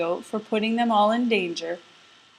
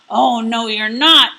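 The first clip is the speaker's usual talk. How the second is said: loud troll threat; loud, gruff troll refusal; troll shouting; 8 dB louder than usual. Acted. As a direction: bellow it like a greedy troll. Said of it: "he shouted".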